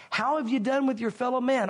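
ordinary talk

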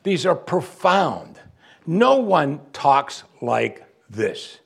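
slow and high, each word weighed; hushed, drawn-out wonder; slow, weighty awe. Real, not acted. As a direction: let the awe slow you right down. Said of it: "These statements are, as I said, audacious".

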